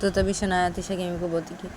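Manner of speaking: ordinary delivery, nothing marked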